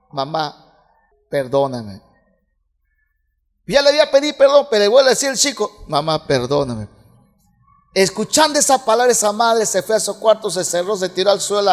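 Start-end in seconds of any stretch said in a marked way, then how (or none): 0.1-2.0 low, soft, humble plea to mama; a repentant son quietly begging his mother; pleading child. Retold or imitated. Son begs mother again. 5.9-6.9 put-on pleading child. Son asks again.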